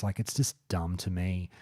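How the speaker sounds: usual speaking voice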